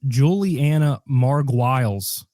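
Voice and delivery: MARGULIES as mar-GWILES, slow and deliberate; slowly butcher MARGULIES to rhyme with miles; whitewashed pronunciation. Shown as a demonstration. Host whitewashing the name for Ryan, mar-GWILES for MARGULIES. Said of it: "let me whitewash this for you".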